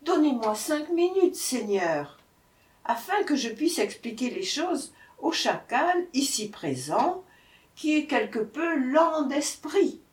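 high-pitched plea; pleading. A narrator's reading. Brahman begging the tiger for five minutes, pleading. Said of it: "le malheureux brahman plaida".

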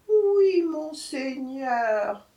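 high, very drawn-out obedient reply; meek jackal. A narrator's reading. Jackal humouring the tiger, meek. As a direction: stretch it into a submissive whine.

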